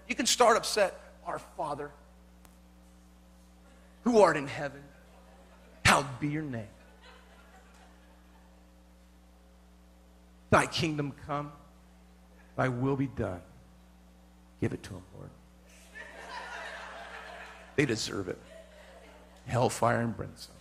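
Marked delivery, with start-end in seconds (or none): 1.3-13.4 low, hushed mock-pious praying; a lower, softer voice of pious prayer. Mock-prays the Lord's Prayer. 14.6-20.5 very low, muttered spiteful prayer; a low, grudging mock prayer; spiteful prayer aside. Bitter aside inside the prayer.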